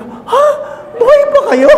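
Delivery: very high, loud laughing disbelief; high laughing surprise; mock shock. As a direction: act stunned she's still alive. Priest's joking shock.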